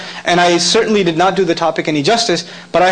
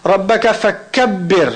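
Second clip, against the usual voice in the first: Quran recitation; much faster than usual. Shown as a demonstration. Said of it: "When I recited the Arabic".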